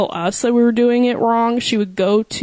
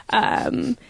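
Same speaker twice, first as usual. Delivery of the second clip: usual speaking voice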